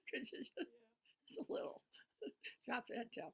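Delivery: high, giggly imitation of a complaint; laughing mock request. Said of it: "most people have said to me".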